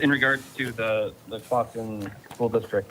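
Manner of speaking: ordinary delivery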